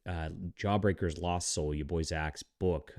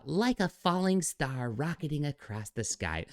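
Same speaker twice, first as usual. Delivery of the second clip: slow, higher, sneering Bond-villain recital; mocking pompous reading; 7 semitones higher than usual. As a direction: read it like a smug Bond villain, slowly. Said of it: "They always talk like this".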